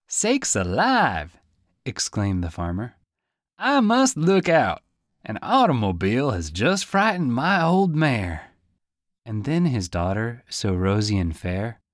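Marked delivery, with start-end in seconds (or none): 0.1-1.4 high, slow startled farmer; drawn-out rustic exclamation; a startled old farmer, higher; old farmer exclaiming. Acted. 3.6-8.5 high, loud fretting farmer; worried old farmer calling out; a flustered farmer, higher and louder; old farmer, alarmed. Acted.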